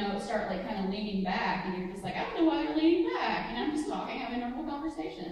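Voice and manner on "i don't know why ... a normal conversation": higher, louder manic rush, oblivious; pressurized speech. Shown as a demonstration. Playing the manic talker who misses the cues, rushed and loud. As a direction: keep babbling as people lean back. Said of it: "you talk, like, really, really fast".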